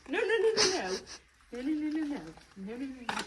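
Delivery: high, loud rapid-fire nos, easing off; quickly head off a nosy horse, pitch up; urgent no-no-no. Real, not acted. Stopping the horse grabbing the present.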